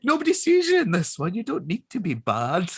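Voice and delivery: high, fussing mum voice; high mock-mum telling her child off. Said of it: "She was like".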